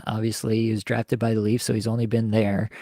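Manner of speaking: usual speaking voice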